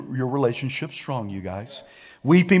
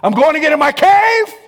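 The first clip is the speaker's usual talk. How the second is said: whiny flesh; 12 semitones higher, 8 dB louder than usual. Pretend. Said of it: "My flesh wants to hide out".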